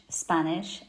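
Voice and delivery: usual speaking voice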